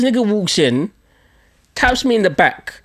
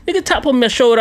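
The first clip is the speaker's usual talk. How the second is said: normal talking voice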